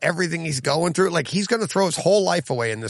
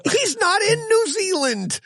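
squeaky, much higher disbelieving pitch; high incredulous whine at the picky critic; incredulous high protest. Real, not acted. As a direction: protest it in a pitched-up, half-laughing voice. Heated mock argument about accents.

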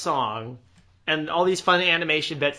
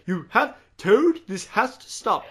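stuffy scolding, higher and louder, slowing down; stuffy Rat; 5 semitones higher, 5 dB louder, slower than usual. Acted. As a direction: play a stuffy, exasperated character laying down the law. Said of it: "I love how stuffy, uh, Rat is".

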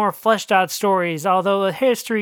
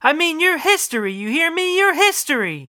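agitated wife; 8 semitones higher than usual. Acted. Wife repeats accusation at husband, high and insistent. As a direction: press him hard, voice up.

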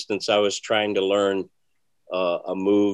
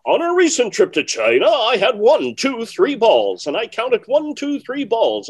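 high, loud, formal stage patter; much higher and louder, a mock performer voice; stiff magician patter; 12 semitones higher, 7 dB louder than usual. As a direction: announce it like a showy magician reciting a routine. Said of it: "it's like procedure and describing what you're doing".

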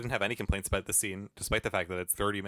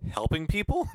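mock puzzled; 7 semitones higher, 3 dB louder, slower than usual. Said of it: "And he just says".

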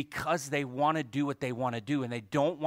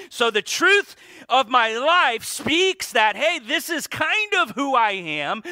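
loud, high, driving preacher's voice; preaching at full force; 11 semitones higher, 10 dB louder than usual. Acted. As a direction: preach it out to the congregation.

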